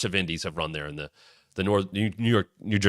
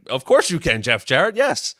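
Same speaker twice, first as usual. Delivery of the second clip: starstruck yes; 8 semitones higher, 8 dB louder than usual. Retold or imitated. Fan thrilled by Jarrett's request.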